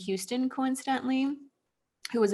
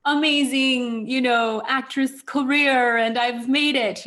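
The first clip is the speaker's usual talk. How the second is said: louder, higher, stretched-out elation; giddy self-quote; 4 semitones higher, 7 dB louder than usual. Retold or imitated. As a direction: act out your starstruck younger self. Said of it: "I had this idea that I had made it".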